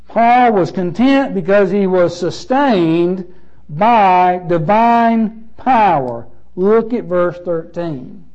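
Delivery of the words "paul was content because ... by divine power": slow, emphatic. Real, not acted. Repeats the point slowly.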